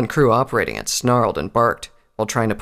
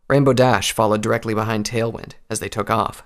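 usual speaking voice

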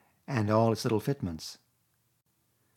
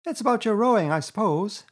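Rat, much higher, all innocence; innocent air; 10 semitones higher, 5 dB louder than usual. A narrator's reading. Rat teasing Toad about rowing, innocent. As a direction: play dumb, sweetly. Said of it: "with an innocent air".

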